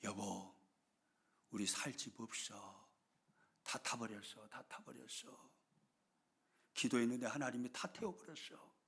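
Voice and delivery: a lightly acted tearful lament; tearful wife. Wife tells her husband their home burned, softly tearful. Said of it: "울면서 이야기합니다".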